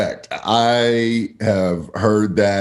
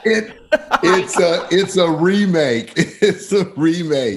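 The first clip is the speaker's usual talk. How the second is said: laughing; 9 semitones higher than usual. Real, not acted. Laughing at the joke.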